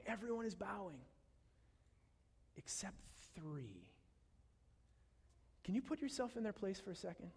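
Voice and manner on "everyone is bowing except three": softer, dropping to a whisper on except three; dramatic whispered reveal; hushed reveal. A narrator's reading. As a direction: let it fall to a whisper.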